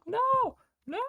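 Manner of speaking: very high mock wail; retold high-pitched cry of denial; imitated wail. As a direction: wail it as distraught mom, without volume. Mother's wailing reaction, retold.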